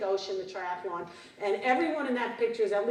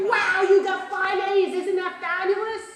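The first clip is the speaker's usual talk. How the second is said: cheerful praise; 7 semitones higher, 4 dB louder than usual. Retold or imitated. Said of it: "We wanna say, like".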